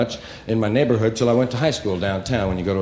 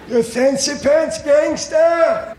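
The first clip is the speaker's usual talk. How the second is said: shrill-pitched, much higher, louder, slow shouted insult; 5 dB louder, much slower than usual. Retold or imitated. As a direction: yell after a fleeing kid like an old merchant.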